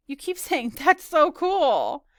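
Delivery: high, loud, drawn-out mock gush; exaggerated, stretched-out imitation; teasing mimicry. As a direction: tease by aping her catchphrase. Friend mimics her repeated catchphrase.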